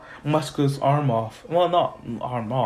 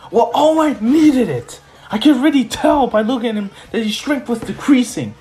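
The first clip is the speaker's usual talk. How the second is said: high, loud, heated rant; hammed-up anime villain rant; 9 semitones higher, 5 dB louder than usual. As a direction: rail at the hero who ruined the plan. Villain ranting at hero.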